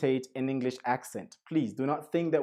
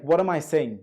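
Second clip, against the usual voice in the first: normal talking voice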